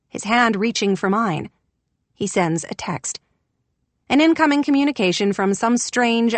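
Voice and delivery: ordinary delivery, nothing marked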